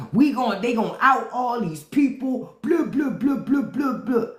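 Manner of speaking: mocking Republicans. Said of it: "Republicans were all excited".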